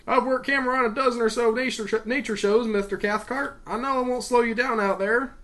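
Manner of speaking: lightly voiced, keen crewman; friendly cameraman. A narrator's reading. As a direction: reassure the boss in a friendly way. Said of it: "satisfying and friendly Australian accent".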